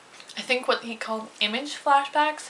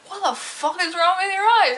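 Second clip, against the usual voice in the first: higher, louder mock alarm; imitating shocked piercer; 8 semitones higher, 5 dB louder than usual. Piercer gawks at her huge pupils, mock shocked. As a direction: play the startled piercer.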